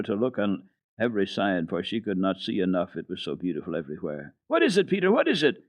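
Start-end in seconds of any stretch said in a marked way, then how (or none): 4.5-5.6 high, pressing eager question; girl eagerly asking Peter about the glow; eager cry. Acted. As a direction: demand an answer, thrilled. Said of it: "cried Heidi again".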